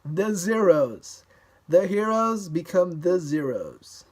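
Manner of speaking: theatrical drop on zeros; mock dramatic. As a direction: play up the joke, heroes become zeros. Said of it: "would sound like les zero".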